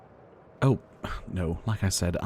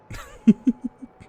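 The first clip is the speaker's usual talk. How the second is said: amused laugh; 7 dB louder than usual. Acted. Amused about Shogun display.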